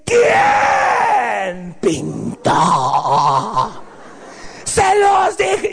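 slow furious yelling mother; angry mom. Retold or imitated. Mother discovers the kids drew everywhere, furious shout. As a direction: bellow it like a mom finding the walls drawn on.

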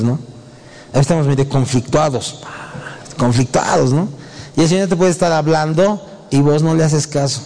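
usual speaking voice

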